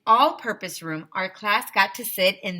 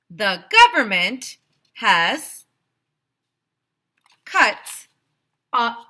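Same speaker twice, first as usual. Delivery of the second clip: mayor's speech; 3 semitones higher, 8 dB louder than usual. Acted. Mayor explaining the budget to the school, loud. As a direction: address the crowd gravely.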